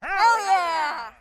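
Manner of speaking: loud, high whoop of joy; excited cheer. Acted. Cheering about the mission.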